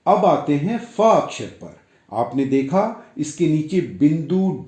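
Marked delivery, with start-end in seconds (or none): none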